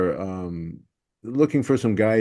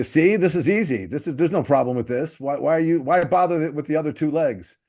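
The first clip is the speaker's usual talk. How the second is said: higher-pitched mock demonstration voice; comic act-out; 5 semitones higher than usual. Comedian pushing the microphone with his nose, playful act-out. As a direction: act out the bit, protesting that it's easy. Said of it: "I picture, you know, doing the thing".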